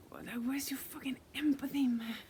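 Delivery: outraged, half-whispered demand at the spirit; indignant whisper-shout. Real, not acted.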